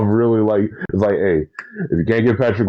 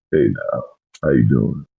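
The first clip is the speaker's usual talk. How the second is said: much lower smooth ladies' man; macho voice; 7 semitones lower than usual. Shown as a demonstration. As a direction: drop low and play it cool. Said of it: "gotta be the guy who's just like".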